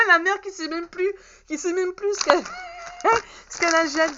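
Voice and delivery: high, loud words through real laughter; laughing at herself. Real, not acted. Vlogger laughing at herself for forgetting her purchase, laughing. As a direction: giggle it out, mocking your own forgetfulness. Mother forgets what she bought.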